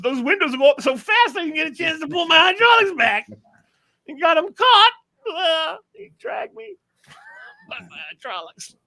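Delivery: very high, strained squeal; mock pained panic. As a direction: squeal the tale in hammed-up agony. Replays being caught in the window.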